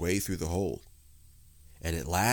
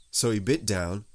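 plain reading voice

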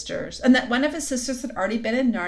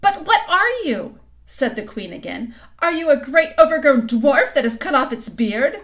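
imperious demand; 6 semitones higher, 8 dB louder than usual. A narrator's reading. Queen demands again.

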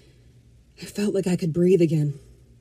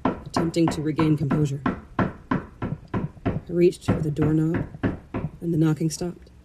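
ordinary delivery

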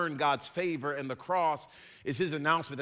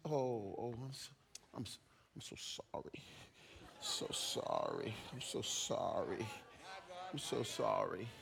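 much softer, lower cooing; hammed-up pastor cooing to offended churchgoer; mock hushed coddling; 5 semitones lower, 10 dB quieter than usual. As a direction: hush and soothe a crying child, mockingly. Mock coddling hurt feelings.

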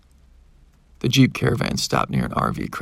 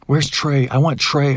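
loud, higher distraught demand; crying out; 4 semitones higher, 7 dB louder, slower than usual. A narrator's reading. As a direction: cry out desperately for a loved one. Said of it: "I cried out".

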